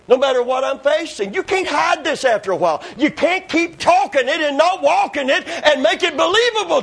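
high, driving pulpit delivery; fervent preaching. Acted. Preacher warning against talking without walking it, fervent preaching. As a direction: press the point home, climbing in pitch.

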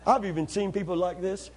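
ordinary talk, nothing marked

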